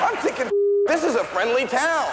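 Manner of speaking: high cartoon kid voice telling a story; squeaky storyteller voice for the whole bit; Bobby voice. Stand-up clip, high voiced.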